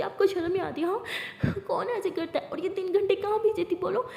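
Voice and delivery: high-pitched angry scolding; गुस्से में डाँटना. A narrator's reading. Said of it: "Lakshmi का पारा हाई हो जाता है". (गुस्से भरी ऊँची आवाज़ में डाँट)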